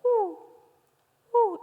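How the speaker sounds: high, loud imitated chimp "uh, uh" hoots. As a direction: hoot like an alarmed chimpanzee. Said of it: "Hacían". (ululatos de chimpancé imitados, agudos y fuertes)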